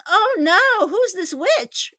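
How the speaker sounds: high, loud, drawn-out indignation; mock outrage. Streamer reacting to a friend's unknown attacker, mock outraged. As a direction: act out being scandalized on a friend's behalf.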